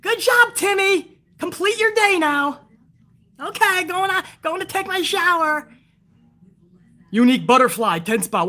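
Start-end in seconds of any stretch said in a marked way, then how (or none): 0.0-2.6 mock mom voice. Mom answering the troll kid. 3.4-5.7 put-on whiny kid. Troll kid obeys his mom.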